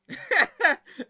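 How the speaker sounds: a big, high burst of laughter; loud laugh. Real, not acted. Laughing hard while telling the viewers about the rude customer.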